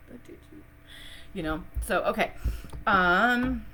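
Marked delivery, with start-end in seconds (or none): 0.1-0.6 breathy voiceless whisper, teasing; whispered doubt. Acted. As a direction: whisper the doubt like a secret aside.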